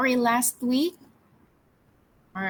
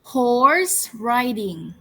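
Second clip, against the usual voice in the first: slow model word; much slower than usual. Shown as a demonstration. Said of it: "repeat after me".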